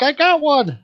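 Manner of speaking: put-on high, loud excited young hero; excited Luke. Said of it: "he's like".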